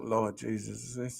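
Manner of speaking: low, hushed mid-prayer mutter; church whisper. Acted. Bored worshipper during a long prayer, whispered. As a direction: murmur it softly under cover of the prayer. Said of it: "That's a whisper".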